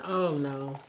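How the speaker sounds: ordinary delivery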